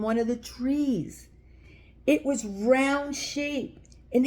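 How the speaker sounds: put-on gentle read-aloud for little kids; children's story reading. A narrator's reading. Reading a picture book aloud.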